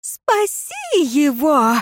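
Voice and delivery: high, drawn-out desperate plea; stretched, high-pitched imploring shout; girl's cry. A narrator's reading. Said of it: "закричала девица".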